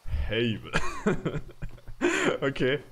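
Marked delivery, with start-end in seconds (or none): 0.0-0.7 Hebel loud, with a stretched long A, HAAAY-bel; drawn-out name. Shown as a demonstration. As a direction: stretch the first vowel of Hebel as in hay. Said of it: "Let's keep making it longer".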